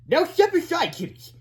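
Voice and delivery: high bossy voice; Mister Sneely. A narrator's reading. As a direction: brush the kids aside. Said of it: "said Mister Sneely".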